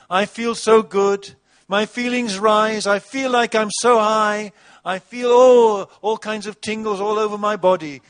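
high, loud, gushing parody of a self-centred worshipper; mock worship song. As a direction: ham up a me-centred praise chorus. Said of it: "Let's write a church song".

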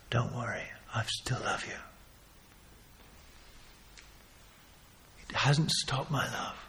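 soft whispered comfort; tender whisper. Acted. Jesus comforting Peter across the crowd, soft whisper. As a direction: hush and reassure.

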